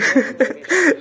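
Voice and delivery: short, high real laugh; high giggle. Real, not acted. Streamer giggles at the guest still talking. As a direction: giggle at the endless story.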